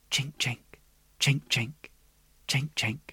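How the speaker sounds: whispered chinking. A narrator's reading.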